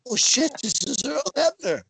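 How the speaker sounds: high, startled voice, caught off guard; a jump up in pitch, shocked; sudden realization. Retold or imitated. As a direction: panic as it dawns who it is. Sudden realization mid-quote.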